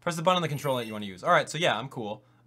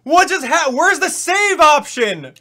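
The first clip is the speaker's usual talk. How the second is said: much higher and louder, yelling in real panic; panicked shouting; 12 semitones higher, 15 dB louder, slower than usual. Real, not acted. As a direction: scream at the screen as the save disappears. Streamer's save file vanished.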